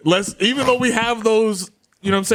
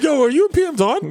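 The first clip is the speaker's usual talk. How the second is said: imitating locals; 9 semitones higher than usual. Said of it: "They were like".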